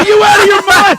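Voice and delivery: high, loud disbelieving yell; retold shout. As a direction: yell it in mock outrage. Retold shouting at friend in car.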